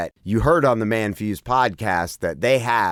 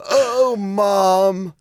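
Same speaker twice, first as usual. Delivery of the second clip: higher, hammed-up moan; mock moan; 7 semitones higher than usual. Giving the worst moanable name example, mock moan. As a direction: draw it out as a joke moan. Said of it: "as you were moaning".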